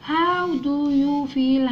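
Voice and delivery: slow, drawn-out words for learners; slow model reading. Shown as a demonstration. Teacher reading the question for children, slow and drawn-out. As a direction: read it out slowly, word by word.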